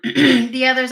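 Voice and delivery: usual speaking voice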